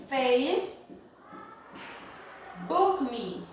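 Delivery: Facebook, long pause, then me; broken up with long pauses; word-by-word dictation. Shown as a demonstration. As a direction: say it piece by piece, as if writing it. Writing the phrase out while saying it.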